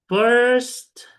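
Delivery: high, loud drill command; crisp teacher command. Shown as a demonstration. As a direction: bark it like a classroom order. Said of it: "teacher demonstrates classroom command".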